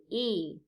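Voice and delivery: "E" as a long drawn vowel; long E sound. Shown as a demonstration. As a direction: hold the E, long and clear. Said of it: "long E sound".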